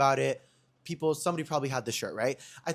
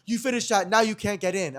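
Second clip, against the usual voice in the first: higher-pitched mock guard telling-off; scolding refusal; 7 semitones higher, 4 dB louder than usual. Guard refusing club entry after the drink, scolding. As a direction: turn them away in a raised voice.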